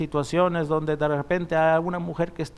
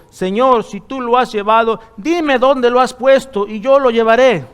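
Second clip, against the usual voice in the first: louder, higher pleading line; 7 semitones higher, 8 dB louder than usual. Acted. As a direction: beg him to tell where the body is. Reading Mary's plea to gardener.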